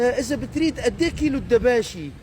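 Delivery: a Hasakah woman faking Damascene speech; imitating a Hasakah woman. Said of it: "تجي وحدة كمان من الحسكة". (حسكاوية تتصنّع اللهجة الشامية)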